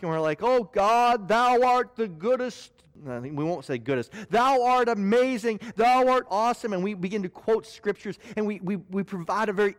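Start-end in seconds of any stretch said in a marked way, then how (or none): 0.4-2.7 higher, louder, grand and slow mock prayer; pompous prayer. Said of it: "put on our intellectual mask". 4.3-6.7 louder, higher mock-lofty prayer; pompous prayer. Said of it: "our intellectual mask".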